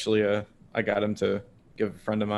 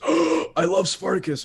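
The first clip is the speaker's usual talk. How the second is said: imitated fan excitement; 7 semitones higher, 4 dB louder, slower than usual. Friend's delighted reaction, retold.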